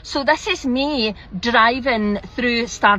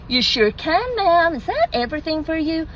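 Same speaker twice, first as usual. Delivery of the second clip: high-pitched American drive-through server; mock American sing-song customer service; put-on American accent; 7 semitones higher than usual. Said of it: "forgive the accent".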